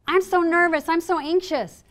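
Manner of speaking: high-pitched acted anxiety; mock nervous. Speaker voices pre-game inner dialogue, mock nervous and high. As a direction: play at being worked up and anxious. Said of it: "They're not anxious and nervous".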